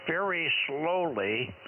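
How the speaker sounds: drawn-out slow words; very slowly. Shown as a demonstration. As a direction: stretch each word out slowly. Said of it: "If you speak".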